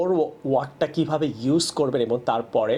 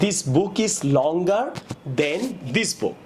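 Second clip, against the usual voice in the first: slow teaching read; 2 semitones higher, much slower than usual. Shown as a demonstration. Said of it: "তাহলে".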